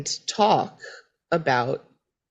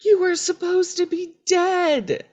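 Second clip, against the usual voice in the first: high-pitched mock-astonished exclamation; 13 semitones higher than usual. Felicity looking at living Elena, astonished. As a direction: exclaim it in acted disbelief.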